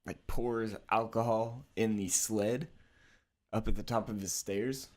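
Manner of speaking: ordinary talk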